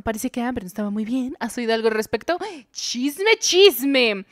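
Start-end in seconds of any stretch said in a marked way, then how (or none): 2.7-4.2 gossip glee. Real, not acted. Eager for gossip.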